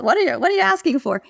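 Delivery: puzzled workman. Retold or imitated. Workman's baffled reply.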